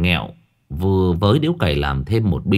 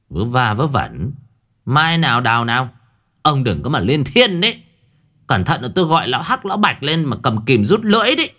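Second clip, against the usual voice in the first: put-on higher, fussy old uncle threatening; uncle Cẩn grumbling; 4 semitones higher than usual. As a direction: huff and threaten the cheeky boys. (cậu Cẩn dọa, giọng cao cằn nhằn)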